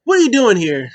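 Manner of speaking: high, very loud mock tantrum; imitated indignant yelp; hissy fit. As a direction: burst out again in mock outrage. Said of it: "Sammy has a hissy fit".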